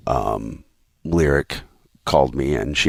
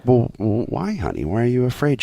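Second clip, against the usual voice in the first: soft, higher reassuring question; gentle dad; 7 semitones higher, 3 dB quieter than usual. Retold or imitated. Dad asking his scared daughter why, tenderly. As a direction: coax it out gently. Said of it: "And I was like".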